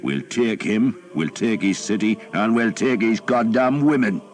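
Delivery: captain rousing archers before the siege; rallying war cry. A narrator's reading. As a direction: whip up the troops. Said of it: "loudly enough for the nearest archers".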